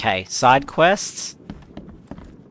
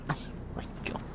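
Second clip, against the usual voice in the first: exasperated whisper; 17 dB quieter, much faster than usual. Real, not acted. Exasperated in game.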